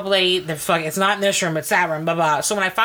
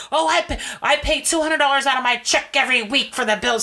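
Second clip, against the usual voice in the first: whiny excuse voice; 6 semitones higher than usual. Retold or imitated. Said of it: "ex answers the judge".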